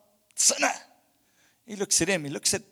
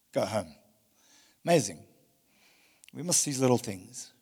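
ordinary delivery

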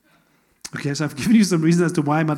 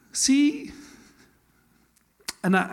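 ordinary talk

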